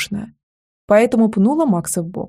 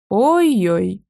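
lamenting. A narrator's reading. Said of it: "запричитала Лера".

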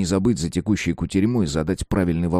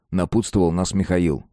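usual speaking voice

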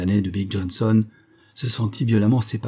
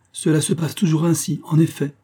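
higher, lightly ironic voice; gentle irony; 7 semitones higher than usual. A narrator's reading. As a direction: raise the pitch in mild mock agreement. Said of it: "doucement ironique".

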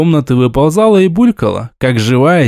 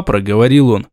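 ordinary delivery, nothing marked

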